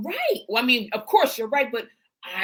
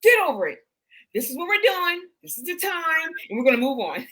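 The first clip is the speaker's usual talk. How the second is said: blunt dismissal; 4 semitones higher, 4 dB louder than usual. Retold or imitated. Voicing a director's blunt order.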